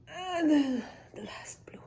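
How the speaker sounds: hushed whisper on the last cap. Shown as a demonstration. Teacher whispering the last blue cap to children. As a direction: whisper it like a careful secret.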